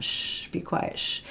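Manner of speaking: soft "shh, be quiet" hush; "shh" as a quiet-please hush; shushing demo. Shown as a demonstration. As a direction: shush someone gently. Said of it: "like that sound".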